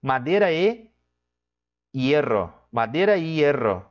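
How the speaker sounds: hierro said with the I almost silent, like ero; sound of the word. Shown as a demonstration. Teacher showing how hierro sounds in speech, I nearly silent. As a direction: glide past the I of hierro. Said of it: "no próprio som da palavra eu vou dizer".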